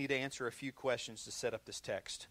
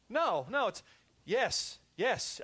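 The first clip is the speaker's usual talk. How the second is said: excited exclamation; 8 semitones higher, 5 dB louder, much slower than usual. Real, not acted.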